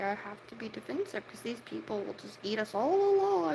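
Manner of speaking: ordinary talk, nothing marked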